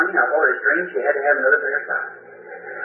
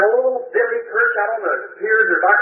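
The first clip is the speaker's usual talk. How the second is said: higher, keen fisherman's tip; imitating a fisherman friend sharing news; eager fisherman; 6 semitones higher than usual. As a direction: tell him the fish are biting. Fisherman friend voiced in story.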